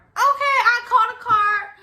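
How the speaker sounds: high, loud mock-obedient reply; exaggerated, fed-up okay; exasperated compliance. Exasperated reply to dad's order.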